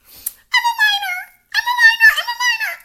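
very high, loud mocking screech; mocking shriek. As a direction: screech it like a hysterical kid. Mocking again, even higher.

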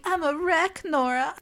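loud, high, hammed-up distraught mother; distraught mom. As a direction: play a frantic mom wailing down the phone. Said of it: "her mom's just like".

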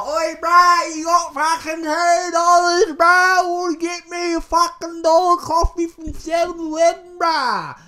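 high, loud, shouting Aussie junkie; hammed-up junkie shouting at a stranger for two dollars; junkie on the bus. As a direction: yell it like a bus junkie begging for coins. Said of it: "some junkie on the bus being like".

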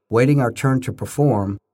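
plain reading voice

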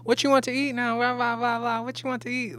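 high-pitched mock New Orleans accent; New Orleans waitress. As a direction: rattle off the order question as a caricatured New Orleans server. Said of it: "take a trip down to New Orleans".

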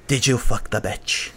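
imitating a Scottish accent cat voice. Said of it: "the Scottish accent cat where he's like".

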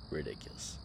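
whispered scoff. Real, not acted. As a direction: breathe it out voiceless. Repeats verdict under breath.

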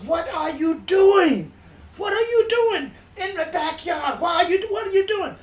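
high, loud banshee shriek in imitation; retold screaming. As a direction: imitate an outraged woman screaming her questions. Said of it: "She screamed at me".

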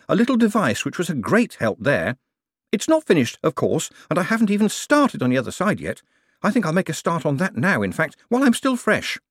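high, eager voice of an excited inventor; Clamp's voice. A narrator's reading. Clamp to Moist, eager and pleased with himself. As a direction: bubble over like an overexcited tinkerer.

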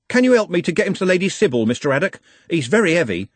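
high, fast, urgent request; rushed plea. A narrator's reading. Trev carrying his friend towards the constable, urgent plea. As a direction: gabble it, asking for help.

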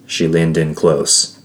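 ordinary delivery, nothing marked

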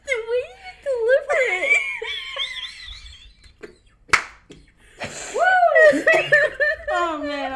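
can barely speak for laughing. Real, not acted. Cracking up at her friend's joke delivery, real laughter.

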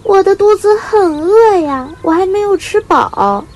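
put-on high, loud young bird's complaint; deliberate whiny yelling. A narrator's reading. Hungry chick clamouring to tired parents, deliberate loud whining. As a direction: cry out loudly in a high child-bird voice. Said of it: "小白故意嚷道".

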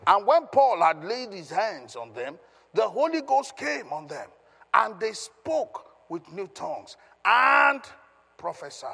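emphatic preacher reading out scripture; scripture reading aloud. Acted. Reads scripture aloud to congregation.

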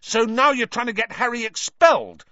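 loud, high-pitched accusation; high, heated shouting; furious. A narrator's reading. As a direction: explode at a friend in outrage. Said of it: "said Ron furiously".

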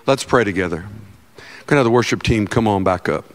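usual speaking voice

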